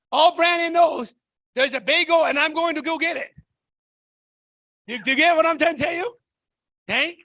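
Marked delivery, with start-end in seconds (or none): none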